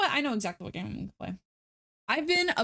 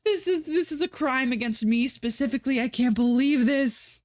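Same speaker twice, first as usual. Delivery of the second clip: half yelling; 4 semitones higher than usual. Real, not acted. Streamer yelling at the Steam sale, crying-laughing protest. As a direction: nearly yell it, laughing through the anguish. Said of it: "devolve into yelling again".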